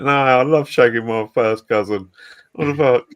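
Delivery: mocking a cousin-loving MP, voice put on; mock quote of the MP. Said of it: "That he's like".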